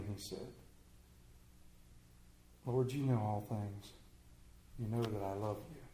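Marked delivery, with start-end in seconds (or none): 2.7-3.9 soft, low plea; quietly wounded, voice dropped; hurt Peter. Acted. Peter answers the Lord's third question, hurt and hushed. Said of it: "Peter was hurt". 4.8-5.8 soft, low, subdued answer; quietly wounded, voice dropped; hurt Peter. Acted. Peter answers the third asking, hurt and subdued. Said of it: "Peter was hurt".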